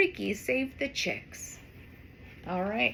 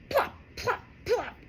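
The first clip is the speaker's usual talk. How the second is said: plop sounds; 9 semitones higher than usual. Acted. Sound effect apples falling.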